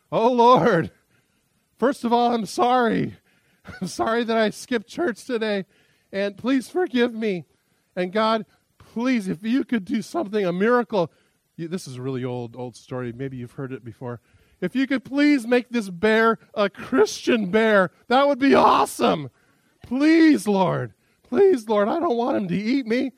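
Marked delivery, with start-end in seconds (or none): 0.1-11.1 high, loud pleading cry to God; desperate prayer. Acted. Hunter preacher begging God to spare him, high pleading prayer. 14.6-23.1 high, loud, slow begging that cracks into a shout; frantic pleading prayer. Acted. Preacher crying out to God as the bear comes, frantic loud plea.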